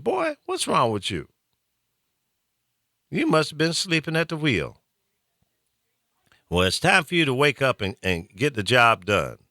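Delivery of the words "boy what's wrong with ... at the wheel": lightly imitated Southern mom, raised pitch; a hint of a mother telling off her son; Southern parent scolding. Host voicing his mom scolding lawmakers asleep at the wheel. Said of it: "those Southern parents are".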